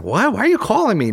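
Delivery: worried mother roused by a late call; alarmed mom. Retold or imitated. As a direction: voice mom caught off guard at night.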